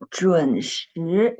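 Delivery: 准时 with a rising tone on 时; second tone on 时. Shown as a demonstration. Teacher models the corrected tone, 时 rising in second tone. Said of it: "It's the second tone".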